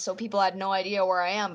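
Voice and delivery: ordinary talk, nothing marked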